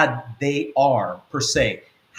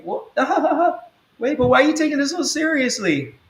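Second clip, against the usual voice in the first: mocking sister laughing it off, much higher; dismissive laugh; 13 semitones higher than usual. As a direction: laugh away her sister's hurt. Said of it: "the sister's like".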